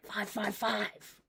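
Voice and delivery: slow and very soft, drawn out; quiet, slow. Shown as a demonstration. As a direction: murmur it slowly, barely audible. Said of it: "ah, five five five".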